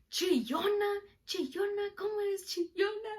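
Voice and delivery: high, loud teasing scold in Spanish; mocking high-pitched chant; imitated mom. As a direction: needle a crybaby, mimicking mom. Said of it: "And she's like".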